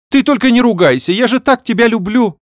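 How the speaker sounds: high, loud wheedling sister; fawning. Acted. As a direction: sweet-talk her to soften the blow. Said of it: "залебезила она". (лебезя, высоким голосом)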